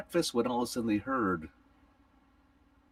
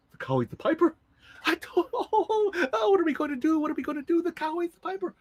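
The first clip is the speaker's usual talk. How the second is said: high, frantic, half-laughing panic; hysterical wife. Acted. Wife panicking over the eaten piper, frantic. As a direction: wail in helpless panic.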